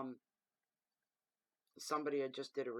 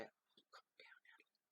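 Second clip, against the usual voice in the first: whispered mock calm-down; 24 dB quieter than usual. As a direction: hush her like a fussy onlooker. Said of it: "people say".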